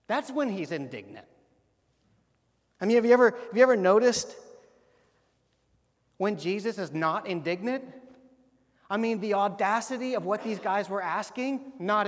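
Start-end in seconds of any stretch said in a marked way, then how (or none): 2.8-7.8 louder, higher, pressing the question home; emphatic question. Real, not acted. Pastor challenging the congregation, loud and insistent. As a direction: lean in and ask it with force. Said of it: "rhetorical emphatic question to congregation".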